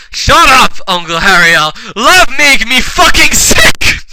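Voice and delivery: loud, high hammy outburst; mock villain rage. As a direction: melodramatic angry yell. Villain snaps at the others.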